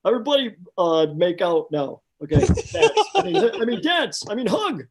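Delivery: high, loud goofy cartoon voice; excitable cartoon dope blurting out orders; hammed-up cartoon shout with laughs breaking in; Ed from Ed, Edd n Eddy. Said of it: "Ed, Ed would say".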